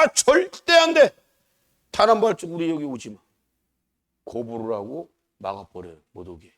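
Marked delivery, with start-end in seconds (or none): 0.0-3.2 high, loud imitation of an adamant refusal; shouted refusal. Hostile nations barring Israel from their land, shouted mock refusal.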